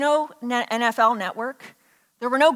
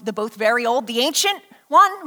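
usual speaking voice

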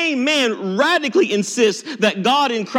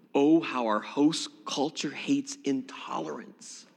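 breathy whispered irony; wry whisper to the congregation; whispered aside; 7 semitones lower, 8 dB quieter than usual. Real, not acted. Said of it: "wry aside".

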